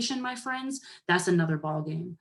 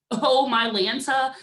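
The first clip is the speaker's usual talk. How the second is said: exasperated surprise; 5 semitones higher, 5 dB louder, slower than usual. Real, not acted. Exasperated at the 25th.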